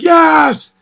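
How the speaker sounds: sudden high, loud cheer of joy; retold excited shout; fired-up yes. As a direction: blurt it out like a win. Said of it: "I was so fired up".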